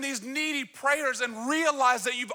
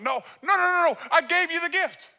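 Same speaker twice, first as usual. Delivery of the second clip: exasperated dad; 6 semitones higher, 4 dB louder than usual. Retold or imitated.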